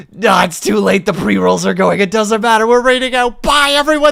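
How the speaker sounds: high, loud hurried goodbye. Real, not acted. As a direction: shout goodbyes over the ads.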